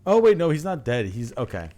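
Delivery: ordinary talk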